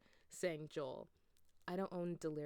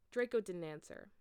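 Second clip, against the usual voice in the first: ordinary delivery, nothing marked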